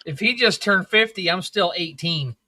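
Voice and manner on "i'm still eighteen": ordinary talk, nothing marked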